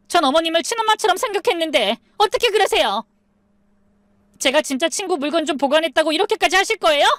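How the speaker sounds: very high, fast, indignant protest; agitated outburst. A narrator's reading. Doubted daughter-in-law rails at mother-in-law, agitated. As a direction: burst out, voice climbing high and racing. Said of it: "갑자기 흥분하기 시작했어요".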